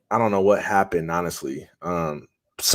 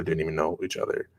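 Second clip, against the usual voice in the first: usual speaking voice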